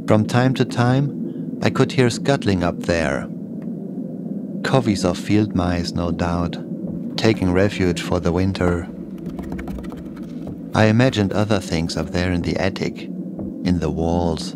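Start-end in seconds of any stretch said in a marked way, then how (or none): none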